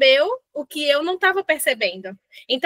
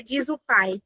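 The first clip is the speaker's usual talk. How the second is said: normal talking voice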